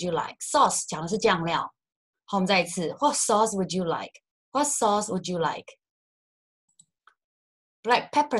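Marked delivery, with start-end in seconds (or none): none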